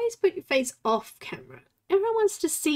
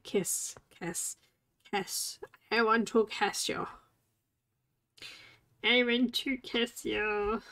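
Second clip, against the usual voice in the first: low breathy cat voice, lower and softer; voicing the cat; 3 semitones lower, 6 dB quieter than usual. Speaking for her cat, a low soft voice. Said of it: "She's like".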